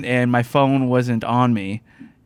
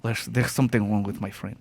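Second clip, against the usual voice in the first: put-on soft, formal Russian accent; a light Russian mover's accent; 4 semitones lower, 5 dB quieter than usual. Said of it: "that accent".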